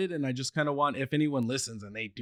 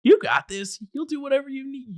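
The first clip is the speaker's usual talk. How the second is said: higher, louder encouraging voice; encouraging parents; 12 semitones higher, 5 dB louder than usual. Retold or imitated. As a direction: cheer your kid on. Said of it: "always encouraging of me".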